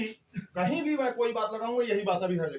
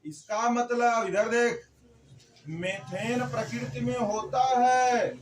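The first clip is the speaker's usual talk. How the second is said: slow and louder, hammering the point; emphatic slow point; 3 semitones higher, 5 dB louder, slower than usual. Shown as a demonstration. Teacher to students, stressing that methane exists in nature, slow and louder. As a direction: lean on every word so it sticks.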